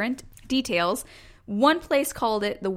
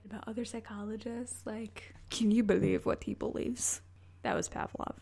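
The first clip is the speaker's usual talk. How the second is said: gossipy whisper; 10 dB quieter than usual. Pretend. Gossip voice at the imagined party.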